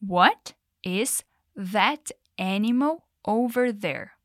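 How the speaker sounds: very slow, each word separated. Shown as a demonstration. Teacher reading the question word by word for learners. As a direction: spell it out one word at a time, slowly. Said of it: "palavra por palavra".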